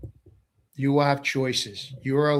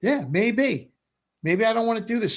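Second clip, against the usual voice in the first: higher-pitched mock governor musing; lightly voiced hypothetical governor, higher; voicing a governor; 8 semitones higher than usual. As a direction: play a governor daydreaming of breaking away, pitched up. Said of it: "the governors of each of the states will go".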